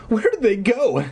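high, shrill startled cry of a little brother; shrill startled boy. A narrator's reading. Eddie, lost in the tower, crying out shrill and startled. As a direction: yelp it out like a spooked kid. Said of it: "cried in a shrill, startled voice".

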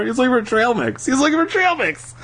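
fast, high-pitched, giddy exclamation; excited guess. Real, not acted. Reader's excited guess aside.

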